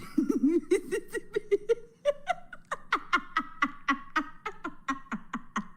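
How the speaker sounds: high, long fit of laughter; long helpless laugh. Real, not acted. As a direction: laugh until you can't stop.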